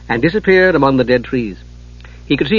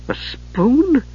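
higher, baffled question; can't believe what he sees; bewildered; 12 semitones higher than usual. A narrator's reading. Palin puzzled at the kender's spoon, much higher.

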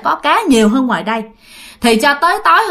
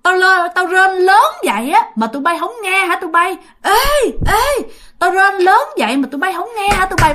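high-pitched spooky ghost voice; creepy ghost woman; 8 semitones higher than usual. Acted. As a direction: call out like an eerie ghost demanding to be heard. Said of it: "lúc đó cái giọng bà thấy ghê lắm".

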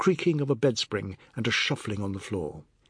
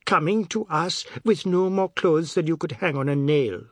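higher-pitched scolding; aggrieved, raised voice; scornful retort; 6 semitones higher, 4 dB louder than usual. A narrator's reading. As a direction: belittle him indignantly. Tulsi retorts in quarrel.

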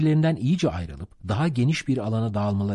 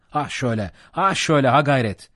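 loud, high urging of rowers; building shout of encouragement; 5 semitones higher, 5 dB louder than usual. A narrator's reading. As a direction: cheer the crew on with rising force. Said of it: "diye bağırdı".

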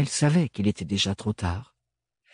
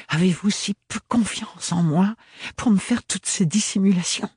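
voiced higher, edged young woman's reproach; Ophélie, tense young woman; 9 semitones higher than usual. A narrator's reading. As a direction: lift the voice for a proud, hurt young woman. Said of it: "poursuivit-elle sur sa lancée". (jeune femme blessée et fière, voix plus aiguë)

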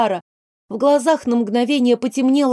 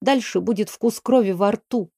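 usual speaking voice

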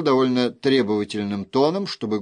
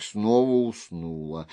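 ordinary delivery, nothing marked

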